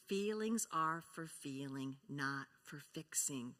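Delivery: slow, measured motto; a teacher reciting the class theme slowly; slow spoken motto. Shown as a demonstration. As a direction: lay it out word by word. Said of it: "Let's say that together".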